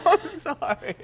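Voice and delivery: high, giggling sorry; laughing apology. Real, not acted. Laughing after sour candy.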